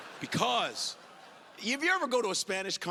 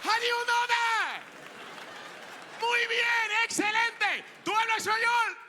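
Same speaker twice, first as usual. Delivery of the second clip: excited praise. Retold or imitated. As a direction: heap praise, shouting high. Spanish locals praising.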